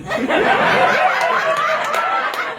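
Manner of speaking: airy high rising laughter; laughing at her own punchline; breathy high laugh. Real, not acted.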